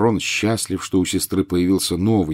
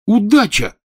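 sudden high, loud blurt; 14 semitones higher, 7 dB louder than usual. A narrator's reading. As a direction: fire it out as the idea hits. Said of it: "вдруг выпалил Рон".

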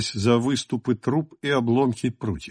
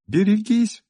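much higher frightened warning; alarmed shout; 9 semitones higher than usual. A narrator's reading. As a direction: cry out a warning in fear. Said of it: "крикнула Кира".

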